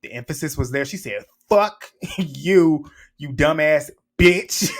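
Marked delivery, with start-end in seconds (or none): 1.5-4.7 every word punched, slow and harder, a laugh breaking in; emphatic cussing out. Retold or imitated. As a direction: spit each word out like she meant them all. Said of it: "she was putting emphasis on them words".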